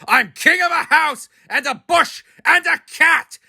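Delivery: high, grand, boastful royal voice; pompous king. A narrator's reading. Turtle king boasts to Mac from his throne. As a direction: crow like a puffed-up turtle king. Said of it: "his royal voice thundered".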